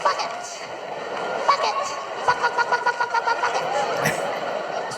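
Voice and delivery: put-on high, tiny repetitive child voice; parroting toddler. Son following dad round the house, high parroting. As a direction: chant it like a toddler with a new word. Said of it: "he followed me around the house going".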